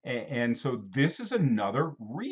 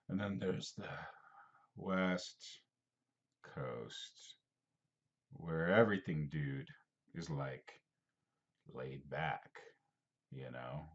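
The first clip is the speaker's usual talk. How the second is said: laid-back dude; 9 semitones lower, 12 dB quieter than usual. Retold or imitated. Mocking laid-back West Coast dude.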